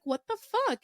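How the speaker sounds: high incredulous outburst; shocked what the fuck. Retold or imitated. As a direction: blurt it out in disbelief. Retold shock at dream.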